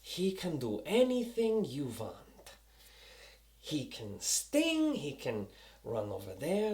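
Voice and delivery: bad German accent, soft and halting; Eichhorst. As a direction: attempt a slow, quiet German villain accent. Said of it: "I have a hard time with the German accent".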